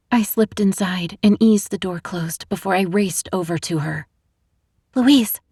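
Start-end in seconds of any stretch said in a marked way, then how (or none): 5.0-5.4 higher, louder panicked call; rushed with panic. A narrator's reading. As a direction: blurt her name in a panic. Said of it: "my voice rushed with panic".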